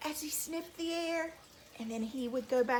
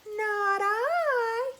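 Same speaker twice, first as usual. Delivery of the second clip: high, drawn-out meowing whine; whiny cat; 9 semitones higher, 5 dB louder than usual. Shown as a demonstration. Storyteller showing children how a cat would say it, high whiny voice. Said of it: "very whiny".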